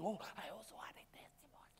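quick, breathy mock whisper of a latecomer. Preacher mimics a latecomer's whispered testimony, mock whisper. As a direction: whisper it like a sheepish afterthought. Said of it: "you came up afterwards saying".

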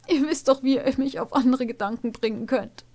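put-on high girlish voice, sulky; pouting young mare, a touch defiant; defiant snort. A narrator's reading. Farah sulks at her teacher, lightly defiant. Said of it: "schnaubte sie trotzig".

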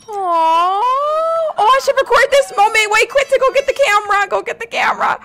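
high, loud, hurried excitement; urgent, thrilled call for the camera; giddy and urgent; excited rush. Real, not acted. Excited at birthday moment.

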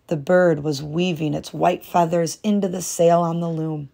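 ordinary delivery

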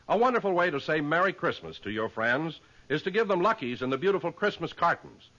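normal talking voice

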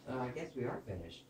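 usual speaking voice

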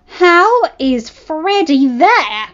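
slow, loud angry snarl. A narrator's reading. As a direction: snarl it in disbelief. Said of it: "Jade snarled".

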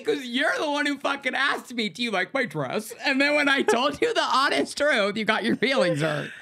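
high-pitched, affronted comeback; indignant retort. Acted. Other speaker fires back in mock quarrel.

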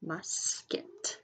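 "Musket" split as Mus, pause, ket; slow, broken-up "Musket" for early readers; syllable by syllable. Shown as a demonstration. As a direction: sound out "Musket" in two separate syllables. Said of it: "sound it out by syllables".

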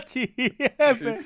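laughing while talking fast. Real, not acted. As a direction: laugh through the words. Laughing at the joke.

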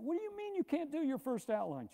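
high, disbelieving question; can't believe what he's hearing; incredulous question. Retold or imitated. Instructor questioning the experienced student, high and incredulous. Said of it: "I said".

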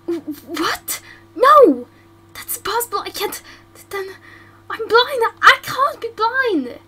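panicked disbelief. Acted. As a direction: panic at the news of blindness. Learns she is blind.

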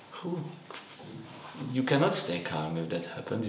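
normal talking voice

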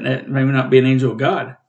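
usual speaking voice

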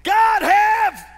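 slow build into a huge high yell; acted full-force cry for help; screaming out to God. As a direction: scream it as a desperate plea. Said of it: "I am screaming out to God".

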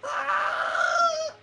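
high, drawn-out shriek; piercing gamer wail; panicked scream. Real, not acted. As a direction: scream in alarm. Panic in game.

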